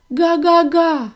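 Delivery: high, loud, slow happy honk; an overjoyed gosling's honk; joyful honk. Acted. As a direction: burst out with joy. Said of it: "зрадів Віллі".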